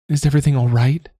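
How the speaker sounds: put-on high, quick girl's voice; worried young woman. A narrator's reading. Bridget asking Siobhan if she's all right, high and quick. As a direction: ask quickly, concerned and a little startled. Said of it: "Bridget asked".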